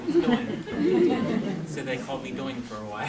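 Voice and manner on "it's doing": high, loud playful quote; hammed-up teasing wife. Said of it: "she told that gentleman".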